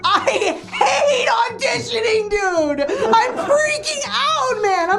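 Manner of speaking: high, laughing panic, slow and strained; panicking. Real, not acted. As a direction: let the audition nerves spill out in a half laugh, half shout. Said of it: "I'm panicking and freaking out".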